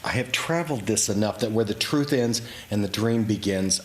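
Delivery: ordinary delivery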